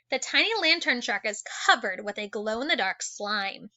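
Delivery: usual speaking voice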